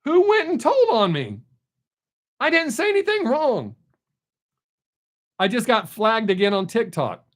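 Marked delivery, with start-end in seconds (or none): 0.0-3.7 shouted, much higher outcry of disbelief; real shock at being reported, yelled high; indignant disbelief. Real, not acted. Streamer finds out viewers snitched, shouting in high disbelief. Flagged again on TikTok.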